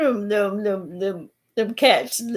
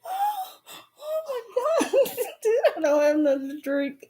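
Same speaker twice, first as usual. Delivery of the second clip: laughing fit; 11 semitones higher, 3 dB quieter than usual. Real, not acted.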